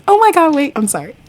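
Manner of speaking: can't contain the thrill; real excitement. Real, not acted. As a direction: burst of real delight. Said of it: "I'm getting a little bit excited".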